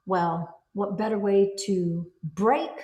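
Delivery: normal talking voice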